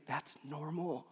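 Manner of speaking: slow, soft comfort; quiet, unhurried consolation; healing reassurance. Retold or imitated. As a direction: say it gently, taking your time. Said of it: "most healing things for me".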